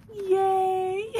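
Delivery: high, loud joyful yay; cheering yay. Real, not acted. Wrapping up the video for viewers, loud cheer.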